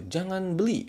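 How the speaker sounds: high, sharp don't-go command; high stern prohibition. Shown as a demonstration. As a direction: forbid it sharply, pitch high. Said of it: "sambil nadanya tinggi". (larangan tegas dengan nada tinggi)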